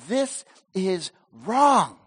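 slow, drawn-out admission; slow declaration. Acted. Ninevites confess to God, slow solemn vow. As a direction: stretch it out as a vow. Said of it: "They said".

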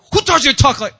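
high, loud mock scolding; parent yelling. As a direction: burst out scolding the kids. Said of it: "learning to talk just like you".

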